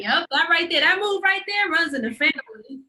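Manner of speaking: high, loud, mock-proud boast; the ghost bragging about a family move, high and showy. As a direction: brag like a pleased elder.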